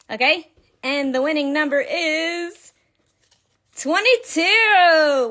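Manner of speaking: drawn-out, high winner reveal; raffle caller. Real, not acted. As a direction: build suspense, then stretch the number slowly. Announces the giveaway winning number.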